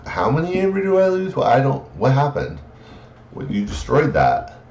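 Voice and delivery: high, bewildered voice of a lost opponent; baffled opponent. Retold or imitated. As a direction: play the confused player who can't follow. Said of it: "your opponent is sitting there going".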